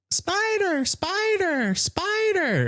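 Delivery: high-pitched imitation of distant panicked screams; mock screaming. Family screaming from the kitchen, high mock scream. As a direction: shriek it in falsetto without much volume.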